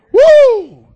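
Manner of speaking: high loud whoop of excitement; preacher whoop. Real, not acted. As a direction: let out a joyful shout.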